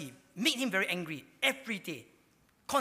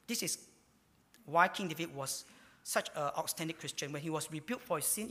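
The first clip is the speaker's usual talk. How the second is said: ordinary delivery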